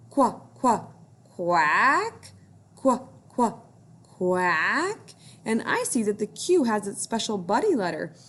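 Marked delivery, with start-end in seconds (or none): 0.1-5.1 quacking like a duck. Shown as a demonstration. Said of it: "Let's try it".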